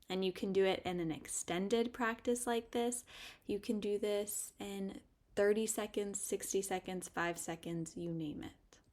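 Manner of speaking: normal talking voice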